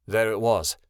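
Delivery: ordinary delivery, nothing marked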